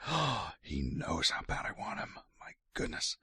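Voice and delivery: soft breathy whisper of desire. Acted. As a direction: whisper it like a secret craving. King's lustful thoughts voiced.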